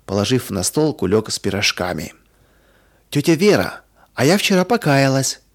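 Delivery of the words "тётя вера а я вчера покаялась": higher, proud little announcement; lightly boastful, raised pitch; boasting. A narrator's reading. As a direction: show off your news a bit. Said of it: "тут же похвалилась Оля".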